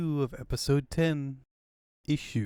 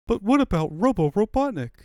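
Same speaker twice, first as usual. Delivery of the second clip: alarmed question; 9 semitones higher, 9 dB louder, faster than usual. Acted.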